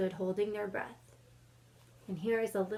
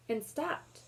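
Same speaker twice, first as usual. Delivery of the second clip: put-on lively read-aloud for kids, a bit louder; story narration; 2 semitones higher, 5 dB louder than usual. A narrator's reading. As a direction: read the chapter to the class with energy. Teacher reads chapter aloud.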